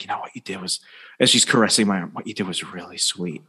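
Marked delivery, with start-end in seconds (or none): 0.0-0.8 very soft imitation of an admirer; imitated soft sweetness. Woman with the fuck me eyes, imitated very softly. 2.1-3.4 soft, low imitation of an admirer; imitated soft sweetness. Woman caressing his arm as she praises him, imitated softly.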